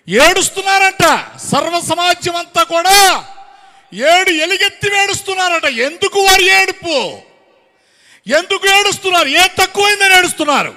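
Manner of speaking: high, shouted sermon questions; full-force preaching. Real, not acted. Preacher challenges why the whole assembly weeps, full-force preaching. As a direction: cry it out to the whole hall.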